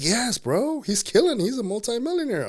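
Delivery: excited retold pitch; hyped-up. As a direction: gush about the partner like a salesman. Don hyping the business partner.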